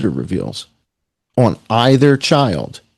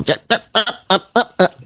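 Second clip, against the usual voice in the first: lightly mimicked riding-crop taskmaster, higher-pitched; rapid-fire mock orders, pitched up; mock bossing; 9 semitones higher than usual. Imitates her bossing with riding crop.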